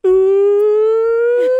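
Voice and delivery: long, high, loud drawn-out ooh; nick noise. Retold or imitated. Recalling a schoolmate's nickname sound, a long high loud ooh. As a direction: stretch a high hooting ooh way out. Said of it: "his nick noise when he'd walk into the class was".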